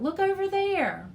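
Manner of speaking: high, loud excited call; loud call to look across the river. A narrator's reading. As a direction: point and shout. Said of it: "he called out".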